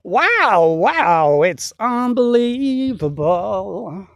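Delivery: loud, drawn-out amazed wow; awed wow. Real, not acted. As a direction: gush over the field in wonder, slowly. Marvelling at the golden wheat field.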